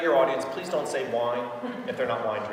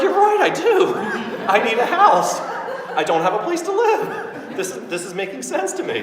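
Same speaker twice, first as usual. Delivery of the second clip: laughing quote; 12 semitones higher, 8 dB louder than usual. Retold or imitated.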